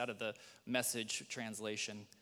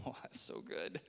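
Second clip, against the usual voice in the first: ordinary talk, nothing marked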